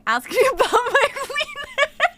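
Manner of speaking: very high, giggling through the words; laughing quote. Retold or imitated. Streamer quoting a chatter while laughing, high giggle.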